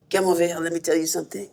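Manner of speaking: lightly put-on French accent, low and confiding; a hint of a French director beckoning. Comic to host, mimicking the French director in a light French accent. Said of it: "says something in a French accent".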